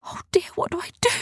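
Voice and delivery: high, hurried, airy worry; fast high-pitched fluster; anxious dithering. Acted. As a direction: fret under your breath. Arthur torn, friend missing.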